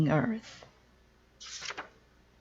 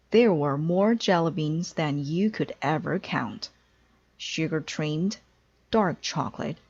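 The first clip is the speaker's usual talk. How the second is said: ordinary delivery, nothing marked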